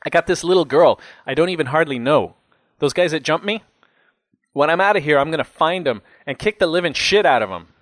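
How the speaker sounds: vent, then threaten payback; riled jailbird swearing revenge; aggrieved cellmate, vengeful. A narrator's reading.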